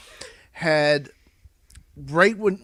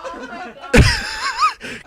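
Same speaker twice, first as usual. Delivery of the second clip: loud cackling laugh; 4 dB louder than usual. Real, not acted. Teller cracks up at own prank.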